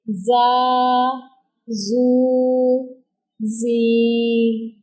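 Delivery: जा, जू, जी drawn out long on a steady pitch; lengthened vowels. Shown as a demonstration. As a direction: hold each of जा, जू, जी evenly, pausing between. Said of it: "ऐसे पढ़ेंगे".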